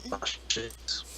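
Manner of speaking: slow teasing whisper. Acted. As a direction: breathe it out slowly, teasing.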